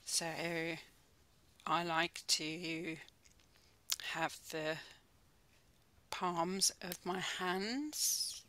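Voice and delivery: calm, unhurried mindfulness guide with long pauses; soothing body-scan voice, phrase by phrase; guided meditation. Real, not acted. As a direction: ease them gently into stillness, leaving space between phrases.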